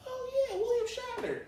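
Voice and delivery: high, surprised realization; squeaky-high surprise at a famous name; sudden recognition. Retold or imitated. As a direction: it finally clicks who he is.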